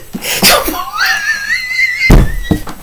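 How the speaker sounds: shrieking high laugh; laughing so hard it goes up high; high wheezing laugh. Real, not acted. Laughing at own story.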